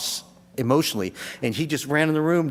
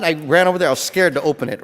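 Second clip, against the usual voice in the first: usual speaking voice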